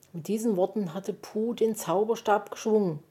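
ordinary delivery, nothing marked

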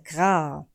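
kurzes, tiefes Krächzen; krächze wie ein Rabe; Rabenkrächzen. A narrator's reading. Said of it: "meldete sich der Rabe". (krächzendes gra)

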